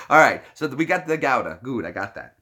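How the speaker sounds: normal talking voice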